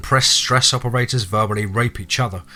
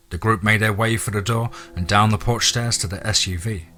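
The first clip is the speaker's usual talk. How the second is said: ordinary delivery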